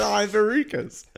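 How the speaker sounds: high, loud embarrassed voice; squeaky confession. Pretend. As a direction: blurt it out embarrassed.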